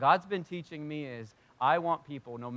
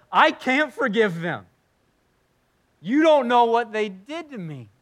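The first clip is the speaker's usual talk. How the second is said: loud, high, drawn-out mock protest; anguished refusal; 8 semitones higher, 10 dB louder than usual. Hurt believer protests against forgiving them, acted loud outrage.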